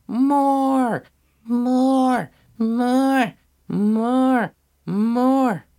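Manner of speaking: high-pitched imitation of a yelling baby; mock baby screams, each drawn out and falling; screaming baby. A narrator's reading. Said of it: "his screams almost sounded like he was yelling".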